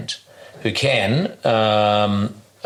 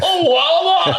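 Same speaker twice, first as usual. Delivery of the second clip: much higher, louder Scottish "Eleven"; mock Scottish yell; 5 dB louder, slower than usual. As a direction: screech "Eleven" at the lift in Scottish. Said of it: "two Scottish guys in the elevator".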